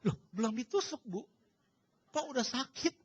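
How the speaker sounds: high-pitched puzzled questioning; bewildered doctor. Retold or imitated. Doctor asking the patient why it already hurts, bewildered. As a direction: imitate a baffled doctor. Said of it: "Dokternya yang bingung sekarang".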